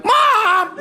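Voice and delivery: high-pitched cry of protest; hammed-up outraged call; mock scandalized yell. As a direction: imitate daughters shouting down their mother. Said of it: "me and my sisters are like".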